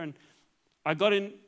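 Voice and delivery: ordinary talk, nothing marked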